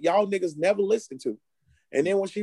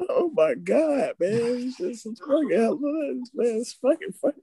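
laughing; 7 semitones higher than usual. Real, not acted. Laughing at shared cover.

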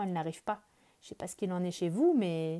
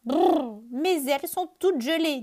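high, louder shivering brrr; shivering bird; 7 semitones higher, 7 dB louder than usual. Acted. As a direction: shiver through it, wings frozen. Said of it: "dit Oiseau".

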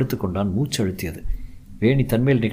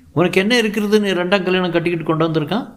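jealous sneer; 9 semitones higher, faster than usual. Acted. First wife sneering at the new bride, fast and high. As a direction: spit the question at her rival.

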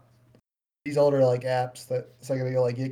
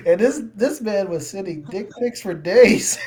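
slow, loud, laughing through the words; can barely get it out for laughing; laughing disbelief; 4 semitones higher, 4 dB louder than usual. Real, not acted. Friend recalling the phone discovery, laughing hard. Laughing retelling shocking find.

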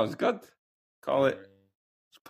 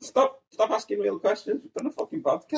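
high exasperated cry; plead, pitch shooting up; 12 semitones higher than usual. Real, not acted. Exasperated plea to stop.

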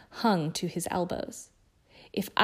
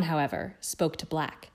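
usual speaking voice

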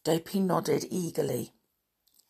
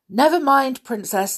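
lightly called reassurance, higher and louder at the start; calling out; 7 semitones higher, 6 dB louder than usual. A narrator's reading. Guard calls to the princess inside, lightly calling. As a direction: call back indoors. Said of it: "the guard called".

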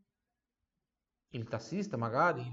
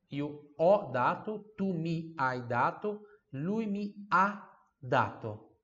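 slow, spaced teacher drill; slow conjugation drill; 3 semitones higher, 3 dB louder, slower than usual. Shown as a demonstration. Teacher drilling conjugations for students, slowly. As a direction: lay out each form slowly. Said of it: "Viu a diferença?".

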